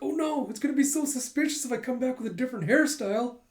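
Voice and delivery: high-pitched fretting wail; comic panic. Acted. Yor frets over her cut-off hair, comic panic. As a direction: fret in a high, put-on voice.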